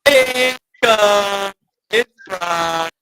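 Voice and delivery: high, loud hyped-up intro with sound effects; mock the wife's idea of the podcast opener; wife's show opener. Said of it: "that's how my wife thinks we start the show".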